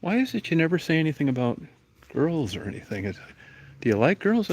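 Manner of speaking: a hammed-up dad quizzing Junior about girls; prying dad. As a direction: play the nosy father grilling his son, high and eager. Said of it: "it's like".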